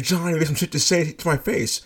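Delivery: high, mock-furious repeat; retold angry yell. Coworker yelling the accusation a second time. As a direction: fling the accusation again. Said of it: "she yells it again".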